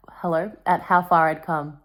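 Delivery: ordinary delivery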